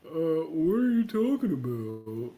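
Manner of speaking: put-on slow, dopey clueless boy; mock a baffled clerk, dragging the words; drawn-out mock confusion; puzzled store clerk. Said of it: "I tell the boy".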